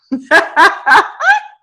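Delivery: loud burst of laughter; high, loud cackling laugh; hearty laugh. Real, not acted. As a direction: crack up out loud. Amused at being petty.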